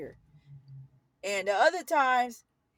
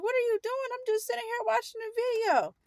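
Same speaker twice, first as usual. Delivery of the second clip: high-pitched, imitated teenage protest; retold whiny objection, pitched way up; indignant protest; 12 semitones higher than usual. Teen interrupted watching a video, high indignant protest.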